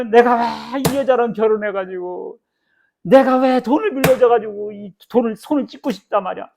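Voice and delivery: complaining loudly about a bad marriage; resentful complaint. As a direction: play a bitter spouse venting. Voicing resentful people complaining.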